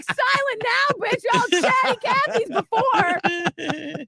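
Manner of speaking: high, drawn-out mock rant; hammed-up meltdown, slow and high-pitched; losing it. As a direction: lash out in a high, exasperated wail. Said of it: "I'm losing my shit".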